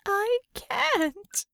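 high, tearful cry; weeping. A narrator's reading. Einhorn breaking down in front of Ramona, high and weeping. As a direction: sob it out, voice way up. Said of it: "Einhorn wept".

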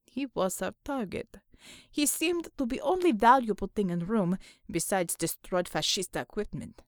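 low-ending Russian-sounding man's voice; measured foreign soldier explaining; stoic comrade driver, reasoning. Acted. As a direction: reason it out in broken English. Untermensch figure in the story.

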